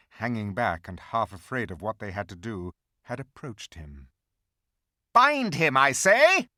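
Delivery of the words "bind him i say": high, loud witch command; the witch orders the hags again. A narrator's reading.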